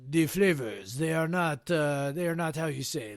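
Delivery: put-on French accent, grumpy judge; mock French accent, hard to please. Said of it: "irascible French dude".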